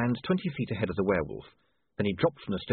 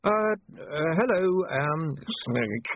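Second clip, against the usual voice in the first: flustered; 7 semitones higher, much slower than usual. Acted. William startled by gnoll.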